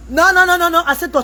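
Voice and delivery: loud, high rapid no-no-no. Real, not acted. Mother ordering her child off the live, loud and high. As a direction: shout them down at once.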